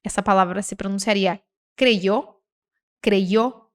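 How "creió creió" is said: "creyó" said kre-YOH, with an i glide. Shown as a demonstration. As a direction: say the y of "creyó" as a vowel-like i. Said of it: "ele pode ter o som de I".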